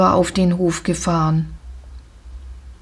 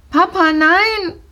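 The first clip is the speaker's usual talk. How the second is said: high pleading cry; 8 semitones higher than usual. Acted. Jolina begs her father not to sell the pony, crying out. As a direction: call out to stop papa. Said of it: "rief Jolina".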